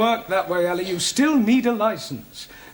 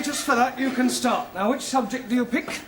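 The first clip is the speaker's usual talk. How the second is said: ordinary talk, nothing marked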